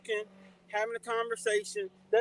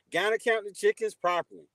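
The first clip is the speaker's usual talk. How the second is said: normal talking voice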